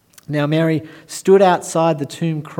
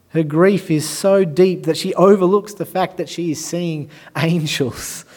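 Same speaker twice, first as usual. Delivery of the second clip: normal talking voice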